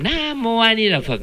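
high, held mock chant; teasing imitation. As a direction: mimic someone chanting to tease. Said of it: "ý nói là chọc mình á".

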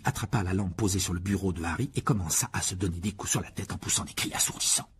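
normal talking voice

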